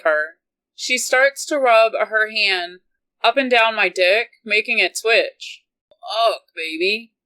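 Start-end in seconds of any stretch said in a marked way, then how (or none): 5.9-7.1 strained through gritted teeth. A narrator's reading. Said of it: "I grit out".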